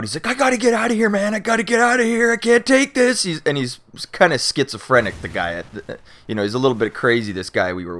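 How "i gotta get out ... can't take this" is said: high, panicky pleading; scared friend in the van begging to get out, panicked; freaking out. Retold or imitated. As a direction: sell a buddy losing it in the back seat. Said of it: "he's freaking out".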